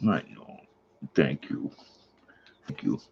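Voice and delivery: hushed coo to the dog; soft coo. Real, not acted. Owner whispering to her dog, soft coo. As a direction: coo it softly, barely voiced.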